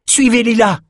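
high, emphatic shouted command; roared order. A narrator's reading. Bannon orders the panicking nobles onward, roaring. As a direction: roar out who to follow. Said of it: "rugit Bannon".

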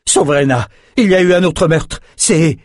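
high, urgent alarm; garde affolé. A narrator's reading. Garde affolé annonce un meurtre. (annonce affolée, voix haut perchée)

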